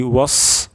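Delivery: ওয়াস ending in thin hissed s; wrong sin sound. Shown as a demonstration. Teacher shows sad turning into sin, wrong sound. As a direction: end ওয়াস on a plain long s. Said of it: "সিন উচ্চারণ হয়ে যাচ্ছে".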